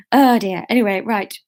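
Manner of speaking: usual speaking voice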